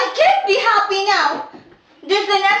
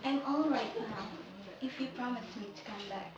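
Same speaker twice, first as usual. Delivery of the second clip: much softer, lower fading voice; fading whisper-soft; 10 semitones lower, 17 dB quieter than usual. Acted. Sabina promises George to wait, fading soft voice. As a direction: drift away as you speak.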